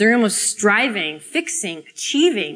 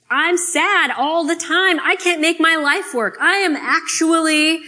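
impassioned confession; 7 semitones higher, 4 dB louder than usual. Acted. Raw confession to strangers.

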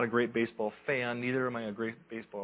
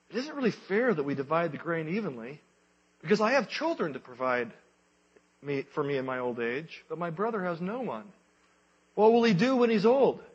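lightly voiced brother, rising worry; 6 semitones higher, 5 dB louder than usual. Married brother's inner voice.

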